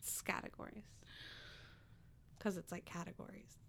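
muttered. Real, not acted. Muttering pun to herself.

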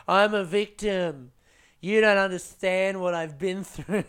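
high, slow put-on whine of self-pity; mock victim whine. Comic mocking a self-pitying victim, high hammed-up whine. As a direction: play the wounded victim, hammed up. Jokes about claiming minority victimhood.

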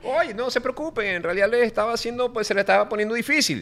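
mock reassurance. Translating the joke line.

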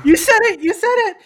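loud, high gleeful accusation; excited. Real, not acted. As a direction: crow it out triumphantly.

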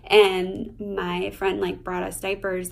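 ordinary talk, nothing marked

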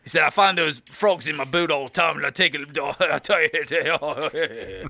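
low Aussie yarn breaking into laughter; Australian accent. Pretend. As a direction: ramble in mock Australian till you crack up. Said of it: "do it in your Australian accent".

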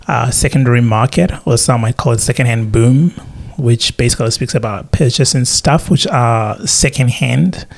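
ordinary talk

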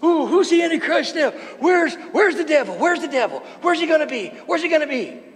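mock frantic searcher. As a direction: play the anxious believer looking everywhere. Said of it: "The emphasis is like".

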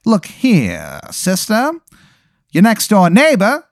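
high, slow wiseguy drawl; old-timey gangster introducing himself; 1920s gangster. Acted. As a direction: cactus gangster saying his name. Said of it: "one of them goes".

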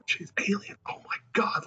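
high whispered amazement; awed whisper. Retold or imitated. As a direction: whisper it in wonder. Radcliffe quoted in awe.

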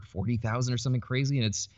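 ordinary talk, nothing marked

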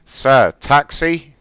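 drawn-out call. Pretend. Prank caller hailing a taxi.

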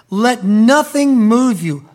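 ordinary talk

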